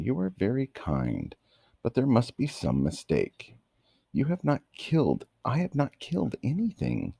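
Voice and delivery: Dorothy, unsure and a little halting; gently protest, as if not sure she may; hesitant. Acted. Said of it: "said with hesitation".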